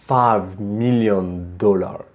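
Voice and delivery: "five million dollar" drawn out slowly, each word given weight; slow and weighty. Shown as a demonstration. Teacher showing students a slow, weighty "five million dollar". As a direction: take your time on "five million dollar". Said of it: "also take your time when, to say s-, a c-, c-, certain thing".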